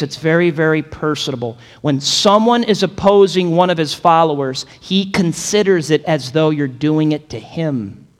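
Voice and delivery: normal talking voice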